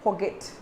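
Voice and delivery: forget said the common wrong way. Shown as a demonstration. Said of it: "Normally we say it forgot".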